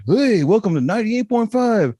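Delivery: high, booming announcer shout; hammed-up station greeting, held up high; radio DJ parody. Podcast fan mocking radio shows he skipped, high DJ hype voice.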